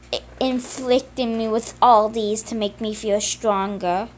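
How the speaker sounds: ordinary delivery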